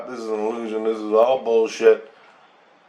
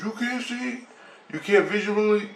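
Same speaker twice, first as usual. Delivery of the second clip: much higher, puzzled alien question; high surprised alien voice; 12 semitones higher than usual. Retold or imitated. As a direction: squeak it up like a baffled alien. Alien surprised at his answer.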